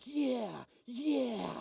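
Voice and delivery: soft mumbled yeah, yeah; imitating president. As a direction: mimic his lazy applause. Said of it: "he's like".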